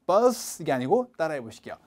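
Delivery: ordinary delivery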